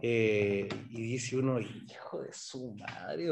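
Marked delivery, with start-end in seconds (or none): 1.6-3.3 muttered curse. Retold or imitated. Cursing corrupt politicians under breath.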